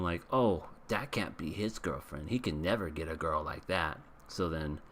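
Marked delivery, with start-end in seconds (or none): none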